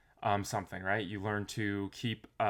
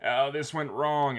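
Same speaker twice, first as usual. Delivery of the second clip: mock complaining; 5 semitones higher, 5 dB louder than usual. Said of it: "complain and be like".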